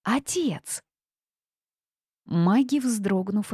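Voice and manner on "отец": higher, thinner call for father. A narrator's reading. Girl calls her father once more, high calling. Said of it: "громко позвала она".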